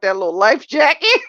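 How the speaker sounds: giggling through the words; laughing while telling. Real, not acted. Telling her viewers about the movie, laughing. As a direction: laugh it out, high and loud.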